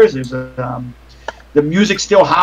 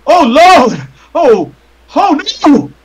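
high, loud cry of panic; panicked scream; 10 semitones higher, 6 dB louder, much slower than usual. Acted. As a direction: cry out in alarm, voice shooting up. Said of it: "he screams".

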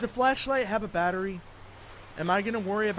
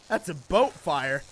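usual speaking voice

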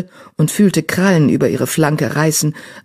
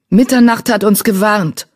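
a reminder called out, louder and higher; raised, urgent reminder; calling out; 5 semitones higher, 4 dB louder than usual. Acted. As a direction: call it out as a warning reminder. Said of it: "rief Brombeerkralle".

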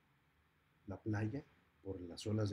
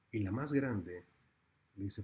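ordinary delivery, nothing marked

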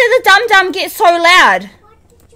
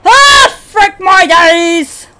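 startled yelps; 4 semitones higher, 6 dB louder, much slower than usual. Real, not acted. Jumpscares in game.

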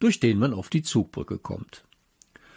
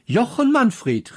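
high, slow stern call; teacher calling out; 12 semitones higher, 4 dB louder, slower than usual. A narrator's reading. Teacher calls the boy's name sharply, high and slow. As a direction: call him to order, pitched up. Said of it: "rief Frau Popotowski".

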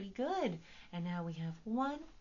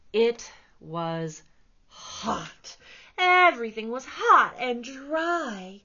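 much louder, higher expressive read; storybook reading; 5 semitones higher, 12 dB louder, much slower than usual. A narrator's reading. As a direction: read it big for little listeners. Reading picture book aloud.

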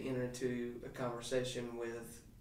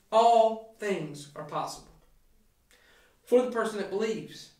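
emphatic preaching; 7 semitones higher, 8 dB louder than usual. Acted. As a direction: proclaim it from the pulpit. Preacher drives home the key verse.

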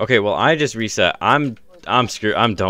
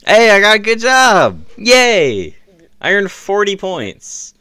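excited cheer; 10 semitones higher, 5 dB louder, slower than usual. Pretend. Mock cheer over homework.

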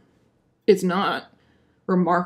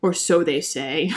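mock dramatic tag line; 6 semitones lower, slower than usual. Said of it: "end with".

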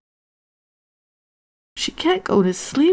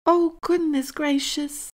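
high, mild exclamation; surprised; 9 semitones higher, 3 dB quieter than usual. A narrator's reading. Jackie spotting something caught in a net, surprised. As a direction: exclaim up high, taken aback. Jackie spots a trapped animal.

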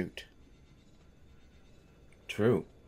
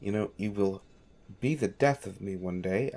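usual speaking voice

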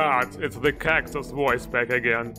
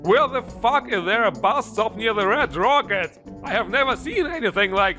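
exasperated; 5 semitones higher than usual. Real, not acted. Exasperated searching.

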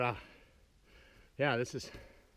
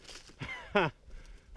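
delighted laugh. Real, not acted. Oh my God.

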